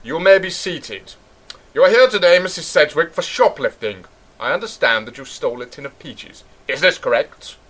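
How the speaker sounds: preside gravely over the court; solemn judge. Pretend. Judge addressing accused shoplifter, solemn courtroom voice.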